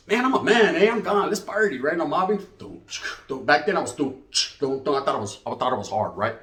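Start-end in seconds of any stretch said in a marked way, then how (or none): none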